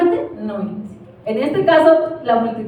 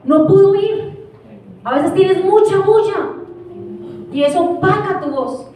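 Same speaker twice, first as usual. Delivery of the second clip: preaching at force; 4 semitones higher than usual. Acted. Pastor pressing the church about the noise, rising and forceful. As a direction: lift it to a rising cry.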